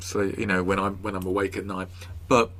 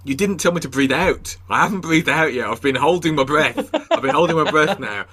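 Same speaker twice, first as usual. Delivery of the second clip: higher and louder, rising in disbelief; retold exasperation; 6 semitones higher, 5 dB louder than usual. Guest replays himself protesting the breathing tape, loud and exasperated. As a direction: protest like you're running out of air. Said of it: "I'd be like".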